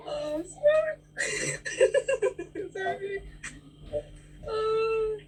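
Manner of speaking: real laughing sobs breaking through the words; overwhelmed daughter crying as she introduces her aunt. Real, not acted. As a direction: laugh and cry at once, voice cracking high. Daughter overcome with emotion on call.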